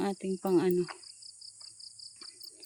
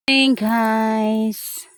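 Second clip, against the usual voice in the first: drawn-out greeting; 7 dB louder, much slower than usual. Real, not acted. Drawn-out greeting while jogging.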